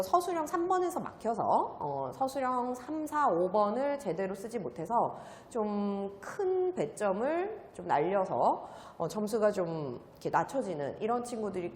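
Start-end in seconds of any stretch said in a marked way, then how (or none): none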